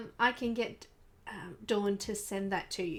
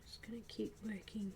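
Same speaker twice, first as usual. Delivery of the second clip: whispering; 12 dB quieter than usual. Real, not acted. Quiet painting commentary.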